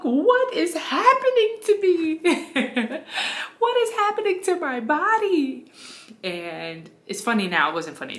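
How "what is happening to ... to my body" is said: high, loud, strained cry with a laugh in the middle; half-laughing, half-frantic plea; panicked disbelief. Retold or imitated. To her doula during transition, a high, frantic cry breaking into a laugh.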